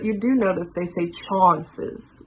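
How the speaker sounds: ordinary talk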